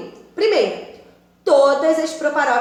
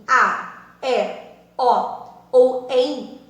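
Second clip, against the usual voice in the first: each ending drawn out slowly, one by one; slow spelled endings; much slower than usual. Shown as a demonstration. (terminações ditas devagar)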